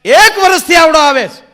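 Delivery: high, loud exasperated yell; the doctor bellows in frustration; mock doctor shouting. Doctor yelling about a patient's haircuts, very loud and high. Doctor's outburst in a comic monologue.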